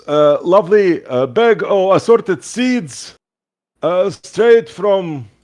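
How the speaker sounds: high, slow, grand merchant blob; goo shopkeeper voice. Acted. Goo shopkeeper welcoming a lady to his store, high and showy. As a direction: sell it like a pompous jelly shopkeeper. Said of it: "He goes".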